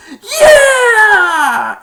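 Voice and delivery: very high, loud yell; victory scream. Retold or imitated. Danny celebrating the win, very high and loud. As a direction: scream with joy. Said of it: "Danny's like".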